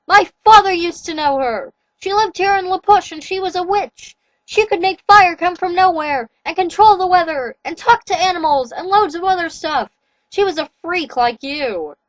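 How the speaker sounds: gloating captor explains the past; werewolf king voice. A narrator's reading. Jacob explains the witch mother.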